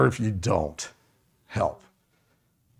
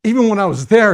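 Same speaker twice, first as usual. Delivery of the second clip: normal talking voice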